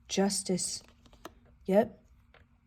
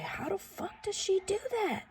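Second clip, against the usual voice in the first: mock amazement; 12 semitones higher, 6 dB quieter than usual. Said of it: "It's like".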